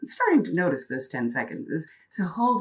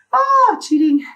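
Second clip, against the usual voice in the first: loud exclamation; 11 dB louder than usual. Real, not acted. Drops to knees, exclaims.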